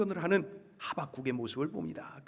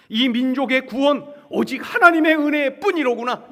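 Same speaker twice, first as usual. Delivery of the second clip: loud, high exclamation of faith; acted awed discovery; fervent realization; 8 semitones higher, 11 dB louder than usual. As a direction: cry out as the prophet who now understands. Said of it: "이렇게 바뀌었어요".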